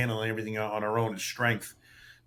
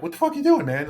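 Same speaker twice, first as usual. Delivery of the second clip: much higher and louder, scolding himself in disbelief; exasperated inner yell; 5 dB louder, faster than usual. Retold or imitated. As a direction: snap at yourself like you can't believe what you just did. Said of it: "And I'm like".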